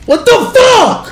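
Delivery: high, stretched-out disbelief; what just happened; shocked. Real, not acted. Confused by game setting.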